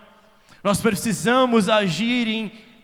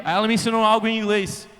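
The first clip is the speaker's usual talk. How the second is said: ordinary delivery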